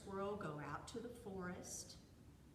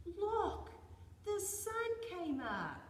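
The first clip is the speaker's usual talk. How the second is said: high, loud, drawn-out announcement; excited animal; 10 semitones higher, 7 dB louder, slower than usual. A narrator's reading. Animal tells the others the sun rose, high and thrilled. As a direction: call out the news, high and slow.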